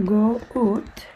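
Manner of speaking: usual speaking voice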